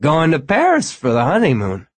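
loud tipsy guest; drunk man voice. A narrator's reading. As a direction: call it out a bit drunk. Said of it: "The man was obviously drunk".

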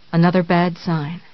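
plain reading voice

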